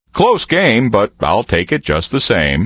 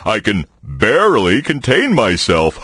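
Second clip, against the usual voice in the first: usual speaking voice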